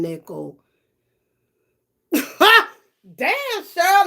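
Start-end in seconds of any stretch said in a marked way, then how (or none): 2.1-4.1 shocked. Real, not acted. Chat comment surprises her.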